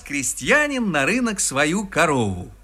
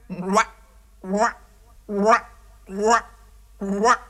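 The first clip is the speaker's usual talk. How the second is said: higher-pitched short frog croaks; croaking frogs; 4 semitones higher, slower than usual. A narrator's reading. Frogs ignore the peasant's counting, croaking on. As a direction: quack out each syllable like a frog. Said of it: "закричали опять".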